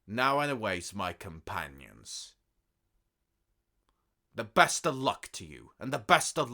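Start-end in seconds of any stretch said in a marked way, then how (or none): none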